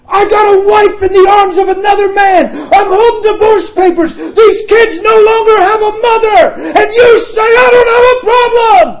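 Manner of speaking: high, loud anguished shouting; vehement. Retold or imitated. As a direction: hurl it at God, vehement. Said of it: "He's vehement".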